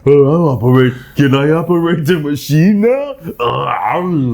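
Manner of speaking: a clueless lug asking permission; slow dim-witted brute. Pretend. Playing the big dumb guy at the machine, slow dopey voice.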